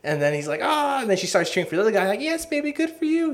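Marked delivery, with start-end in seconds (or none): 0.6-1.0 pained yelp. Retold or imitated. Voicing saw victim's cry. 2.2-3.3 put-on high, sing-song girlfriend voice; mocking high-pitched girlfriend cheer. Girlfriend cheering in Saw story.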